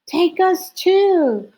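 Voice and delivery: high, loud plea; eager cry. A narrator's reading. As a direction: beg to come along. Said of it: "cried the pretty owls".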